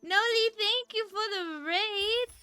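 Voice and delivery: high, slow sugary anime voice; sing-song thanks in a cute VTuber voice; cute anime streamer. Acted.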